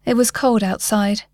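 ordinary delivery, nothing marked

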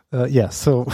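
ordinary talk

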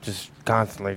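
ordinary delivery

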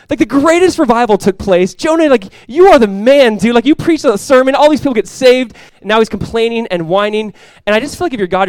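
loud, high, pushing the point hard; fired-up preaching. Real, not acted. Pastor urging the congregation, loud fired-up preaching. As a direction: preach it full force to the church.

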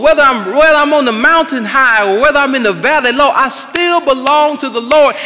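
rally the congregation with each refrain; preacher pounding out "I still belong to the Lord"; full-force preaching. Real, not acted.

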